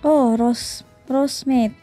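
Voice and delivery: ordinary talk, nothing marked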